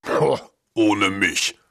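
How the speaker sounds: slow, lightly growled dog voice; a touch of a grumbling dog; growling dog. A narrator's reading. Dog refuses the cat, mildly growling. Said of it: "knurrte der Hund".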